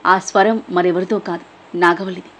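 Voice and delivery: ordinary delivery, nothing marked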